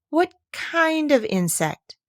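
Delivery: high, slow anxious question; Alice worried it might sting. A narrator's reading. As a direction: ask it nervously. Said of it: "a little anxiously".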